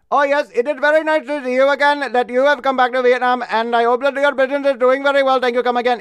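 affectionate Indian accent impression. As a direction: play a friend with a polite Indian accent, hammed up. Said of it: "I would impersonate".

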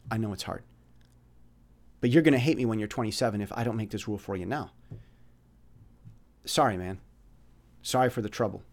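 soft, low, tender talking-to; very gentle. Retold or imitated. Mentor laying down rules with one kid, very gently. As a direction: lay down the rule softly and gently. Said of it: "I said very gently".

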